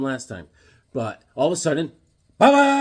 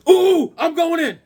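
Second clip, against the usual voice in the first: mock heroic Superman; 12 semitones higher, 5 dB louder than usual. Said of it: "Superman's like".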